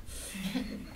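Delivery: a small breathy giggle; short quiet chuckle; quick soft laugh. Real, not acted. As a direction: laugh it off, embarrassed. Boy giggling after giving up.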